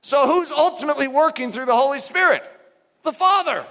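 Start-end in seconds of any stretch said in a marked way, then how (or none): none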